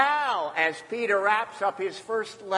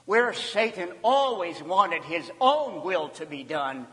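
ordinary talk, nothing marked

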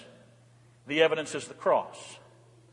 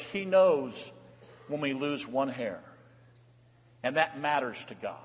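tender; 4 semitones lower, 5 dB quieter than usual. Real, not acted. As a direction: lean in and comfort them gently. Tender quiet passage on God's care.

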